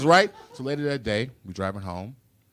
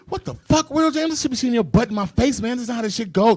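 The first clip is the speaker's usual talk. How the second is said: put-on very high, loud outraged kid; high-pitched shocked kid at the urinal; shocked little boy; 12 semitones higher, 5 dB louder than usual. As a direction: squeal it like a scandalized five-year-old. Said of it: "I'm five, like".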